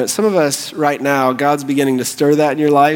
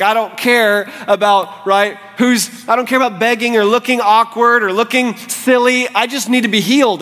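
high-pitched, desperate insistence; begging; 7 semitones higher than usual. Acted. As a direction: plead past all embarrassment. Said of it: "and he begs".

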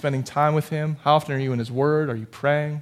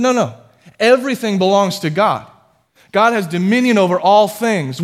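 raised, louder retelling of an impassioned pastor; fired up; 5 semitones higher, 5 dB louder than usual. Retold or imitated. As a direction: pastor getting heated, voice lifted. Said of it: "he started getting fired up".

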